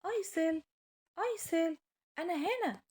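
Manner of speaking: small high calling voice; tiny voice calling. Acted. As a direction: call her name like a tiny creature. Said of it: "سمعت صوت صغير عمال بيناديها".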